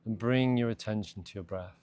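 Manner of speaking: calm, measured meditation voice with long pauses; guided meditation. Real, not acted. Meditation teacher guiding listeners to de-stress after a busy day, calm and spacious. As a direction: ease them gently through each instruction, unhurried. Leads a body scan meditation.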